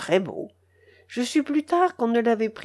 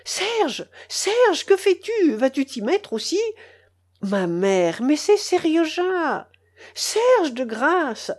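pleading cry; 8 semitones higher, slower than usual. Acted. Uncle calling after the departing nephew, high pleading cry. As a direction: beg him to stay, voice up high. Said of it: "criait-il en courant après moi".

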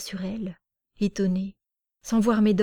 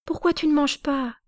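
put-on high little girl's question; puzzled child; 8 semitones higher than usual. Small girl wondering why the uncle won't eat, high childlike. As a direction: ask like a baffled small child.

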